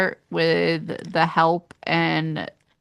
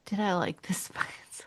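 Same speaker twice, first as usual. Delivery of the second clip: whispered, much softer aside; musing whisper; 11 dB quieter than usual. Real, not acted. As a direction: ask yourself under your breath. Muses to self over the question.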